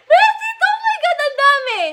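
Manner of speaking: very high, laughing squeal; overwhelmed squealing. Real, not acted. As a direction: squeal through laughter in disbelief. Overwhelmed by many gifts. (patiling tawa sa sobrang tuwa)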